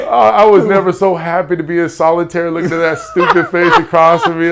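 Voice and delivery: high and loud, bursting with relief; overjoyed. Real, not acted. Overjoyed seeing his buddy Pedro.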